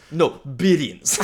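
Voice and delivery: put-on slow, drawn-out accented "No, billions" through a laugh; laughing accented retort; mock accented teacher. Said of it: "anytime me and my brother are talking about numbers".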